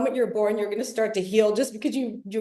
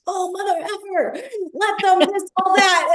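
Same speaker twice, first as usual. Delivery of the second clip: higher, slow exasperated grumble; mock angry outburst; 6 semitones higher, 3 dB louder, slower than usual. Telling the group about a triggered moment, mock angry. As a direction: vent at the trigger, half joking.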